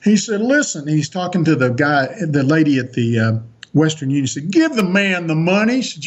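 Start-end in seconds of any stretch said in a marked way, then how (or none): 0.4-0.8 high Texan bark of listen, lightly imitated; imitating a Texan giving orders. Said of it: "he talks like a Texan". 4.5-5.8 higher Texan command, imitated; lightly imitated Texan insisting to a clerk. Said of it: "he talks like a Texan".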